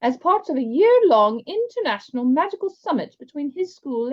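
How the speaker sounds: lightly voiced headmaster addressing the hall; a hint of a stately headmaster; headmaster's announcement. Headmaster announcing the summit to students, lightly voiced.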